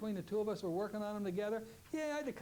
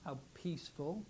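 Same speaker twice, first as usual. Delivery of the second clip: guided relaxation; 2 semitones lower than usual. Shown as a demonstration. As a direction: ease them down into calm. Leads closing relaxation.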